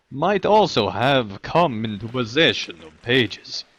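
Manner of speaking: NPC quest voice. Acted. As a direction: read it like a village elder. Reads NPC quest text aloud.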